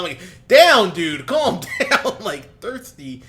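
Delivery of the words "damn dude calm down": loud, high burst of disbelief, laughing into it; mock outburst. Watching the movie, yelling at the boy on screen, loud and laughing. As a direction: blurt it out at the screen. Retelling his reaction to the boy.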